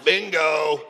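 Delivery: put-on loud, raspy old woman calling bingo; gravelly old bingo lady. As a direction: put on the smoker's rasp of an old bingo player. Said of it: "she had a real gravelly voice".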